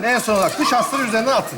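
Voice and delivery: fast, offhand relay of the step; quick repeated instruction. Acted. Man passing the manual's step on to his friend, quick and offhand. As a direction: rattle the step off to a helper. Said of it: "ben okuyacağım".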